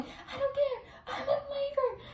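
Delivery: very high, slow whine; hammed-up tearful protest; mock wailing. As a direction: whine it out like someone in labor. Acts herself wailing in labor.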